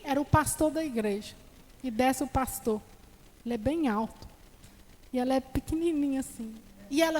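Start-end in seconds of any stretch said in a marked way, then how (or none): none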